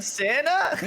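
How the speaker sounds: put-on high-pitched little kid calling out; mock childish cry of discovery; excited child. Comedian playing little Timmy coming around, excited child's call.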